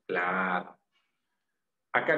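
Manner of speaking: ordinary talk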